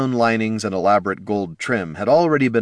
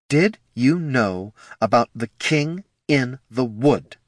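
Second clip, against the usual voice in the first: slow, deliberate, icy demand; cold, measured interrogation; cold and hard; 4 semitones higher, slower than usual. Acted. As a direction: voice hard as iron, word by word. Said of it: "The ranger's voice was as cold and hard as iron".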